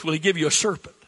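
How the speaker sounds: normal talking voice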